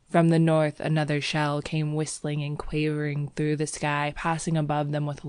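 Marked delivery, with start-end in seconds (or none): none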